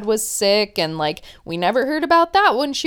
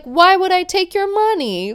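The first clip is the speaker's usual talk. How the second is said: high, loud, incredulous retort; pitched-up mock disbelief; mock indignation; 8 semitones higher, 5 dB louder than usual. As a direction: imitate an accused boyfriend protesting innocence. He acts indignant when accused.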